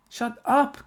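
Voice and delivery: very high-pitched cry; shouted. A narrator's reading. Karen to her teasing classmates, shouting. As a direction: cut them off with a high shout. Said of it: "Karen shouted".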